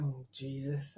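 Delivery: low, very soft grumble; mock put-upon groan, dropped low and quiet; imitated eye-rolling exasperation. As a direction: mimic a weary husband muttering under the breath. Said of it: "rolled his eyes, and was like".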